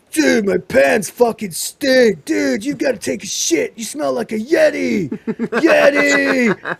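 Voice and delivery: loud, laughing stoner yelling about a Yeti; rowdy drunk guy cracking himself up; drunk party guy. Retold or imitated. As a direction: ham up a wasted party dude. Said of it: "you hear this guy like".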